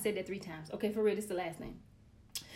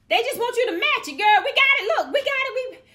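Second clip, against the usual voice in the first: much higher, loud triumphant cry; triumphant excitement; 14 semitones higher, 14 dB louder than usual. Real, not acted. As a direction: shout it, we got it. Finally solving homework, excited.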